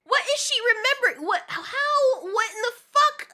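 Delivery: high, loud disbelieving cry; exasperated outburst. Real, not acted. Reacting to the episode, a loud high disbelieving cry. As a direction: shout at the screen in disbelief.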